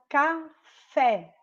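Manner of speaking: "café" slowly, weight on the open fé; "café" with a drawn-out, strong last syllable; stressed last syllable. Shown as a demonstration. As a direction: split "café" and lean on the final fé. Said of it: "é a sílaba mais forte".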